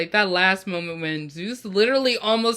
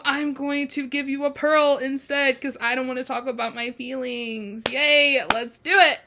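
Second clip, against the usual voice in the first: high, falsely chirpy avoidance; forced cheer; 7 semitones higher, 3 dB louder than usual. Retold or imitated. Host voices Poseidon avoiding his feelings, forced cheer. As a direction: brightly dodge the feelings, pitched up.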